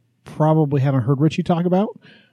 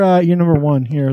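ordinary delivery

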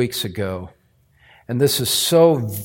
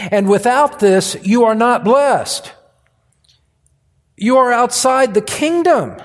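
raised, emphatic pulpit voice; forceful preaching; 6 semitones higher, 3 dB louder than usual. Real, not acted. Preacher warning congregation they are outside the kingdom, forceful preaching. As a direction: preach it hard, pitch lifted.

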